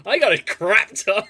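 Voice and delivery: excited high bragging. Real, not acted. Excited bragging about hired gun.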